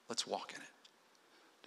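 slow, breathy whispered aside; hushed whisper. Real, not acted. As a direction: let it fall to a slow whisper. Preacher drops to a hush.

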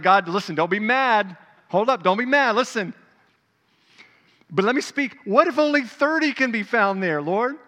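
loud, high, hurried coaxing; calm God down while pushing; placating Abraham. Acted. Abraham coaxing God not to be mad, loud placating.

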